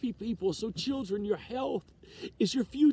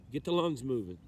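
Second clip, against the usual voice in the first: ordinary talk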